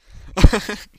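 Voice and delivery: high, loud cackle at his own joke; sudden loud laugh; loud burst of laughter. Real, not acted. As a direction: let out a big laugh.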